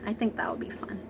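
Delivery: soft unvoiced aside; whisper. Teacher whispering a playful aside to the children. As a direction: whisper it like a little secret.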